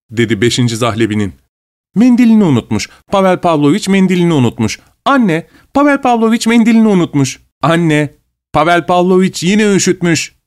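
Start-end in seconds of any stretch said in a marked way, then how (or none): 2.0-10.4 high teasing calls to mother; mock-shouting the news; shouting together. A narrator's reading. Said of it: "diye bağırıştılar".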